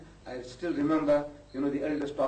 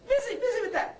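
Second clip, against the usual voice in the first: teasing laugh. Real, not acted. Teasing about shaved mustache.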